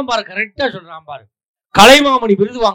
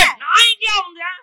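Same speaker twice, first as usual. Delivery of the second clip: much higher startled protest; indignant surprise; 11 semitones higher than usual. Real, not acted. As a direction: yelp in mock outrage. Indignant reply to a jab.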